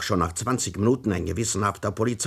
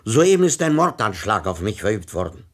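agitated shouting; 7 semitones higher, 11 dB louder than usual. Acted. Victim calls police after shooting.

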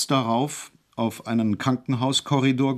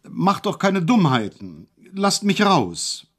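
higher, louder, slow desperate call; 8 semitones higher, 3 dB louder, slower than usual. A narrator's reading. Hejula calling out from isolation to be let out. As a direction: drag it out, begging to be released. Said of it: "Bald aber rief er".

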